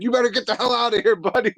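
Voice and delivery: high, fast mock run-off from the old guys; old guys on the porch chasing off the fire chief, fast; old men chasing off. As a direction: hustle him off like cranky old neighbours, quick and high. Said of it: "they're all like".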